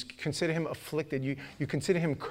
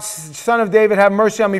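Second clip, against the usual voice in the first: raised, loud plea for mercy; crying out; 6 semitones higher, 13 dB louder than usual. Retold or imitated. Blind Bartimaeus begs Jesus for mercy, crying out. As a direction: call out as the blind beggar. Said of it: "he began to cry out".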